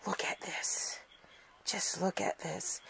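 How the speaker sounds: softly whispered awe; awed whisper. Real, not acted. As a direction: hush it, marvelling.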